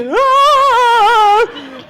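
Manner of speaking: high drawn-out cartoon scream; long high startled wail; Scooby-Doo scream. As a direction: scream like a spooked cartoon kid. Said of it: "like singing something out of Scooby-Doo".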